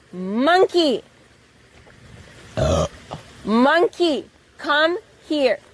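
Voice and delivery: calling a pet across the room; loud call to the monkey. Real, not acted.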